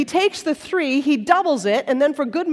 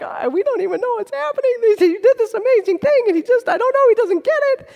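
higher, fast, nervous babble; flustered servants; 8 semitones higher, faster than usual. Retold or imitated. Servants reporting to the king, flustered and higher. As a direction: blurt it out, bewildered and anxious.

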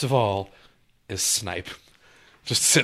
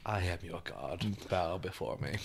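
dark lord; 3 semitones lower, 10 dB quieter than usual. Pretend. Mock god voice after remark.

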